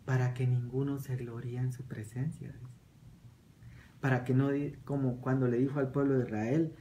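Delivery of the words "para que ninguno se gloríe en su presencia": usual speaking voice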